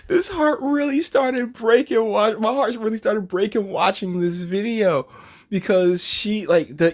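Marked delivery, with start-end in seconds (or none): 0.1-5.1 higher, hammed-up heartbreak voice; pitched-up mock sorrow; mock heartbroken. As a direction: ham up a breaking heart. Repeats his line, mock heartbroken.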